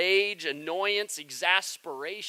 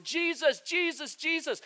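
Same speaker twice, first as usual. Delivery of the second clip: calling out; 11 semitones higher than usual. Retold or imitated. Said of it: "these two guys that are following behind them going".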